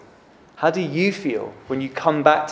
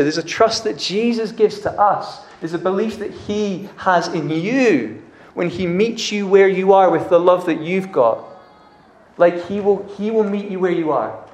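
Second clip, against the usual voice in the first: preaching; 4 semitones higher than usual. Real, not acted. Preaching climax.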